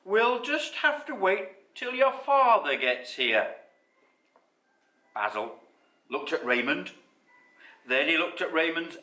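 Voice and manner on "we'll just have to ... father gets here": higher, louder, drawn-out motherly reply; raised, measured storybook mother; mother character. A narrator's reading. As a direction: answer a child, stretching the words. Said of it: "said his mother".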